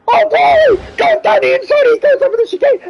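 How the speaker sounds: high-pitched, thrilled commentary peak; excited outburst. Real, not acted. Commentator sees a car go over the chicane, excited outburst. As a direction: burst out high as the move happens. Commentator reacts to dramatic overtake.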